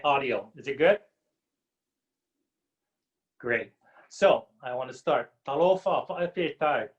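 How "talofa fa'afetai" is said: native Samoan pronunciation of Talofa fa'afetai; Samoan greeting. Real, not acted. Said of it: "that is my native tongue".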